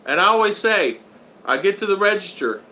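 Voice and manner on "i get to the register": ordinary talk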